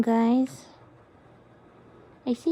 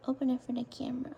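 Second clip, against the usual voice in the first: fast whispered aside; 3 semitones lower, 8 dB quieter, much faster than usual. Real, not acted. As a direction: hurry it under your breath.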